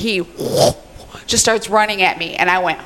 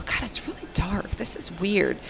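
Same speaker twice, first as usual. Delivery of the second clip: hushed unvoiced whisper; frightened whisper to her husband; 11 dB quieter than usual. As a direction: whisper it nervously in the dark. Whispered aside in scary story.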